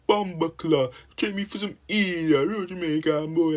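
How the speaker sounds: high-pitched fake Jamaican wannabe; mock Jamaican accent. Mocking wannabes forcing Jamaican slang, exaggerated Jamaican accent. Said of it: "Moving like you're trying to be Jamaican, be like".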